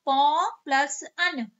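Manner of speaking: teacher dictating each piece slowly; slow split. Shown as a demonstration. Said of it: "जब आपने विच्छेद किया".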